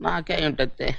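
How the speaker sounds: usual speaking voice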